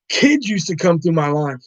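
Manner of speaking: ordinary delivery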